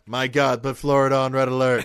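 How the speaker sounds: loud mock emergency call; mock alarm.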